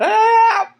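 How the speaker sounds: high mock yell for help, laughing through it; imitated scream for help. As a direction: squeal it out high while laughing. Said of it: "he's just screaming".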